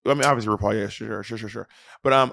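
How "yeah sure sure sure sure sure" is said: dismissive mumbled agreement; soft brushing-off "sure, sure"; muttering. Real, not acted.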